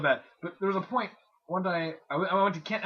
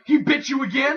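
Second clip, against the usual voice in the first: indignant mom; 6 semitones higher, 9 dB louder than usual. Retold or imitated. Acted indignant mom questioning son.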